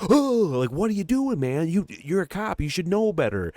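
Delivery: very high, incredulous scolding; acted alarmed protest. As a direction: cry out "oh!", then protest in a high voice. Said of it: "and it makes you go".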